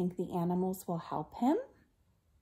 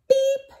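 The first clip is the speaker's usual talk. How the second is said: loud, high horn beep; high-pitched cartoon horn; truck beep; 11 dB louder than usual. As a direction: toot sharply like a small truck. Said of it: "Blue said".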